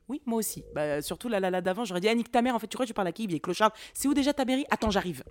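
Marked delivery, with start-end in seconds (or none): 2.1-5.2 mock confrontational outburst, loud; insulte agressive. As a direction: play at picking a loud fight. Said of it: "moi, je l'aurais insulté". (fausse colère, agressive et forte)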